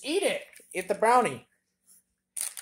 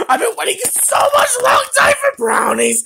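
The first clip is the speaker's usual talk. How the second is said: excited shout; 8 dB louder than usual. Pretend. Toy overjoyed at brownies.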